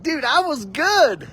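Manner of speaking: put-on high, weepy boy insisting; crying protest. Boy insisting to his dad that he was good, weepy and high. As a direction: sob out the injustice. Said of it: "crying that Santa had forgot me".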